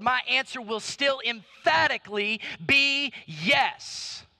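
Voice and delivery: sermon building to a forceful climax; impassioned preaching. Acted. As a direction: declare it, drawing out the final words.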